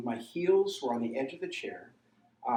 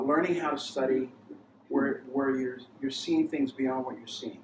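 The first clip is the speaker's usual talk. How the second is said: ordinary talk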